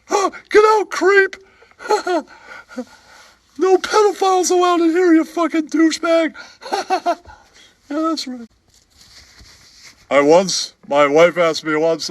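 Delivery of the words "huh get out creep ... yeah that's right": high, squeaky put-on character voice, with giggles; squeaky comic voice. Comic sidekick telling a bar story in a squeaky giggling voice. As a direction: play a giggling oddball sidekick. Same held voice.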